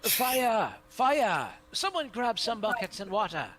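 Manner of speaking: high, loud cry for help; calling out alarm. Acted. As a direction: shout it across the square in alarm. Character raises alarm at tavern fire.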